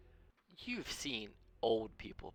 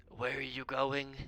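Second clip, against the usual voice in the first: put-on old wizard asking; 5 semitones higher, 4 dB louder, slower than usual. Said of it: "looks up at you all and goes".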